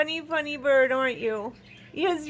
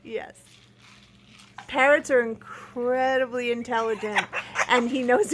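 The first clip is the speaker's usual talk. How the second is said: ordinary talk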